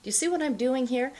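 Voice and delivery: ordinary talk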